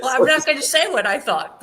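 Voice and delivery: can't hold back the laughter mid-sentence; laughing. Real, not acted. Guest laughing to hosts about what she really thought. Laughing while telling interviewers.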